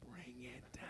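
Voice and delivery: whispered aside; whispered cue. Real, not acted. Leader whispering the motion cue to children.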